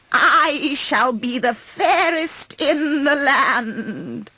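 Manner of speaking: high, slow cackling hag, triumphant; gloating witch voice. A narrator's reading. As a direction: relish every word like a wicked old witch. Queen as hag gloating over plan.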